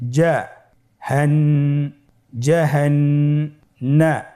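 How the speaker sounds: slow build-up with held nasal n; syllables separated. Shown as a demonstration. Teacher builds the word for learners, slow separated syllables. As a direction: sound out part by part with gaps. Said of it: "পৃথক করে আমরা উচ্চারণ করব".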